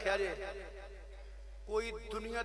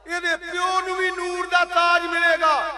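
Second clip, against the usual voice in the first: loud, high, slow proclamation; full-force preaching shout, words stretched; exultant shout; 8 semitones higher, 14 dB louder, slower than usual. Real, not acted. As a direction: cry it out to the congregation. Repeat raised to a shout, father's crown.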